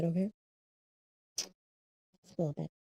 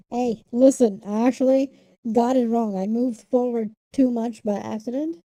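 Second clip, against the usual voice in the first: loud; 8 dB louder than usual. Real, not acted. Streamer explaining a setup mistake to chat.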